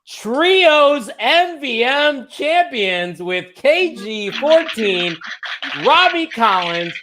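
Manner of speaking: high, slow, drawn-out championship announcement; ring announcer. Pretend. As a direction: proclaim it like a ring announcer, stretching each name. Announcing new title holders.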